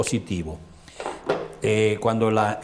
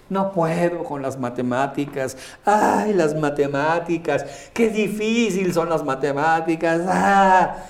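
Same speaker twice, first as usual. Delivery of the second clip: put-on whining child; 5 semitones higher, 4 dB louder than usual. Son complaining to his parent about maths, higher whining voice. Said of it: "tu hijo llega y te dice".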